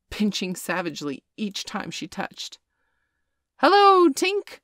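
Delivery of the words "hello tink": high, loud greeting to the sky; calling out. A narrator's reading. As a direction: call up to the fairy. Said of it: "cried the wandering boys".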